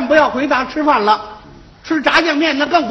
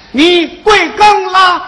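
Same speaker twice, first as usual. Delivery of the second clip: loud, drawn-out question, word by word; slow, careful repeat; 3 semitones higher, 5 dB louder, slower than usual. Acted. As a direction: stretch every syllable for a slow listener. Repeats question after mishearing excuse.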